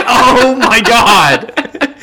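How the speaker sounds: slow, laughing oh my God; laughing exasperation. Retold or imitated. Reacting to the throat-clearing man, a slow laugh. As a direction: drag it out through a laugh.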